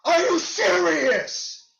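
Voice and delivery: high, loud disbelieving yell; how is that not a foul; outraged at the refs. Real, not acted. Fan yelling at the refs over a non-call, furious disbelief.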